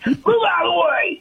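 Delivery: half-shouted order to move; retold shout. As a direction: push past him with a yell. Confronting security guard, shouting.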